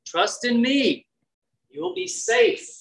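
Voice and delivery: slow, tender reassurance; calm the frightened sheep; reassuring. Retold or imitated. Jesus to his sheep, slow and reassuring. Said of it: "Jesus is saying here".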